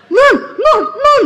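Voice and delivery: high, loud frantic calling; husband yelling. Retold or imitated. Husband yelling for his wife from the laptop, high frantic call. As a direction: call out like he's gone wild. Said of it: "dia teriak, dia panggil saya".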